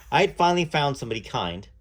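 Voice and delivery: ordinary delivery, nothing marked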